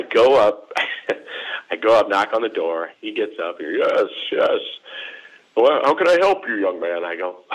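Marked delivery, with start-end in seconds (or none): 3.8-7.0 put-on old professor's greeting, pitched up at first; imitating elderly professor. Professor answering a former student's knock, imitated old-man greeting.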